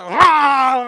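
loud, high exasperated "ah"; fuming yell. Retold or imitated. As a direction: let out a big frustrated holler. Imitates everyone upset, fuming.

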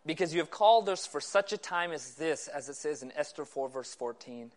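low, hushed, measured praying voice; prayer. Real, not acted. Preacher opening the meeting in prayer to God, low and soft. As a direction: pray softly and reverently, pitched low.

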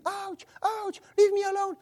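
high-pitched mock yelping; fake screaming. Friend on the couch faking a beating, yelping. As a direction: yelp as if hurt, only pretending. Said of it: "smiling and screaming".

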